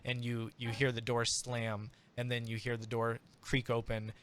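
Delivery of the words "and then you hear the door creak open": ordinary delivery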